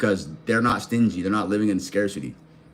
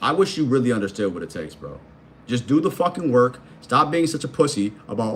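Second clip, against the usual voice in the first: ordinary talk, nothing marked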